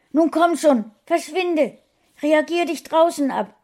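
hoch und laut gerufen; wütendes Rausjagen. A narrator's reading. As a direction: die Katze hinausscheuchen. Frauchen jagt Katze raus. (hohes, lautes Scheuchen)

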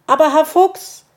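high, loud protesting shout; calling after him. A narrator's reading. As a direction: call after someone running the wrong way. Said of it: "rief ihm Borstel nach".

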